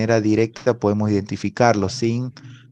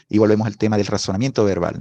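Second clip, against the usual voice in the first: ordinary talk, nothing marked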